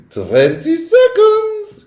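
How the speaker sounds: very high, slow, sung-out countdown; sing-song witch cry. A narrator's reading. Witch taunting the trapped boy, sung high and slow. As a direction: croon the count like a gleeful witch. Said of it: "cried the Grand High Witch".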